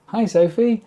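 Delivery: ordinary talk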